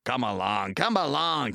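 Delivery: puffed rhythmic coaxing; puffing. A narrator's reading. James coaxing the trucks, puffing. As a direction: urge them along. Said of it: "he puffed".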